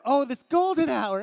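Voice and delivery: high, loud mock delight; acted excitement. Photographer spotting golden hour light, hammed-up excitement. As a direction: exclaim it with hammed-up wonder.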